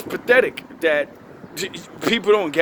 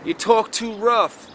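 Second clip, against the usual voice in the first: slow, higher mocking voice of a critic; mocking whiny critic; 4 semitones higher, slower than usual. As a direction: parrot the complainers with a sneer. Mocking critics of his language.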